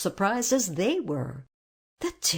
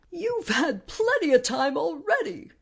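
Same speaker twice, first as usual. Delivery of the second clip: higher-pitched, mildly indignant protest; angry; 9 semitones higher than usual. A narrator's reading. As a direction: object with a touch of anger. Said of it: "said the Tin Woodman angrily".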